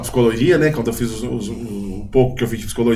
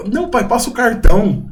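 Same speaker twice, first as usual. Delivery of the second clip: put-on much higher, demanding kid voice; imitating child; 9 semitones higher than usual. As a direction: play a bratty child insisting. Child demanding father pay.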